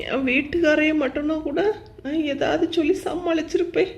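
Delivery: usual speaking voice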